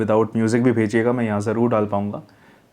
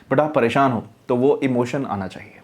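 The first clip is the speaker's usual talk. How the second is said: normal talking voice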